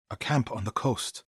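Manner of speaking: normal talking voice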